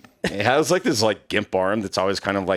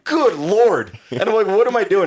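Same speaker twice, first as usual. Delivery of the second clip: incredulous inner voice; 10 semitones higher, 4 dB louder than usual. Retold or imitated.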